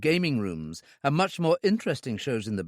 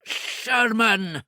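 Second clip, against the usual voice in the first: higher, spat out with hate; hateful; 9 semitones higher, 4 dB louder than usual. A narrator's reading. Owner recognises the agent, hateful voice. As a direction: spit the name in hatred. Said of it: "Hate was in the eyes and the thick voice".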